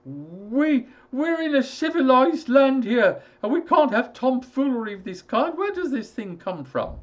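high, loud, indignant father voice; a father bluffing past his fear; high, loud, blustering father; blustering old father. Acted.

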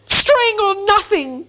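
very high, drawn-out creature shriek. A narrator's reading. Gollum blurts two guesses at once, shrieking. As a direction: screech it out as Gollum. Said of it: "shrieked Gollum".

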